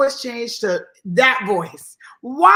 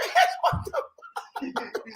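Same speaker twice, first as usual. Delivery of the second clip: shocked; 6 dB quieter, much slower than usual. Pretend. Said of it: "I'm like".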